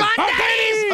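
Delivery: high, loud shouted cheer; catchphrase shout. Acted. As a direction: belt it out like a radio host. Host shouts catchphrase on air. (grito de muletilla)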